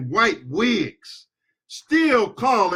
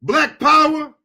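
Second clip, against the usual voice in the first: heated rally cry; 8 semitones higher, 5 dB louder than usual. Real, not acted. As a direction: raise the call up high, fired up. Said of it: "I know I'm getting heated up".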